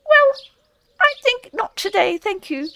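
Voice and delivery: high squeaky polite refusal; hurried field mouse. A narrator's reading. Field mouse hastily turning Rat down, high and squeaky. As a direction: decline fast in a tiny high voice. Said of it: "replied the field mouse hurriedly".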